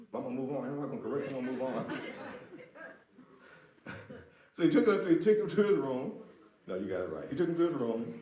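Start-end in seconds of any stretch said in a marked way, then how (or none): 1.9-2.9 short unvoiced chuckle; breathy real laugh. Real, not acted. Preacher amused by the congregation, breathy laugh. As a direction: let a soft laugh escape.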